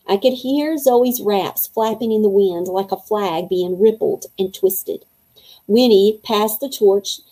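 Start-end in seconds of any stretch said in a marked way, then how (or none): none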